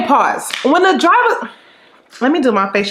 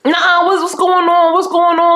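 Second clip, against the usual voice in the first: confused friend; 5 semitones higher than usual. Retold or imitated.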